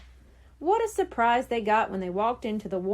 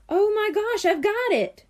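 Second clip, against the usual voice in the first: high, loud burst of discovery; sudden eureka shout; excited cry; 10 semitones higher, 4 dB louder than usual. A narrator's reading. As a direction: blurt it out as the idea hits. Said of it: "Bradley cried".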